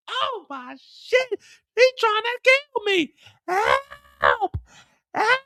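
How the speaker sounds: high crying shout for help; very high hammed crying yell; panicked screaming. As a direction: scream for help while shot at. Mireya screaming shot at.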